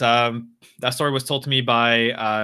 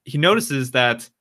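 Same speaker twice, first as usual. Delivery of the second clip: ordinary delivery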